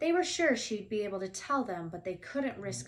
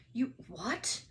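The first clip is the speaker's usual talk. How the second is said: shocked; 2 semitones higher than usual. A narrator's reading. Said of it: "Hagrid looked shocked".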